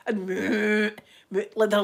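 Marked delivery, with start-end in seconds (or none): none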